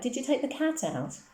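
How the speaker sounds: put-on high, insistent child's question; mild shout, mostly in the pitch. A narrator's reading. Schoolgirl repeating her question to a friend, shouted. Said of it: "shouted Colette".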